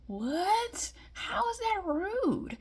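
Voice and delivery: high, breathy disbelief, half whispered; incredulous. Retold or imitated. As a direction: ask it like you can't believe your ears. Incredulous reply to ex.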